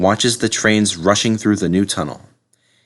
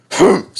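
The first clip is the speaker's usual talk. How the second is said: loud high harrumph; disdainful humph; 8 semitones higher, 6 dB louder than usual. A narrator's reading. Director scorning Gordon, loud humph. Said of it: "said the fat director".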